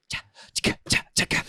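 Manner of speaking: beatbox. Pretend. Beatbox rhythm.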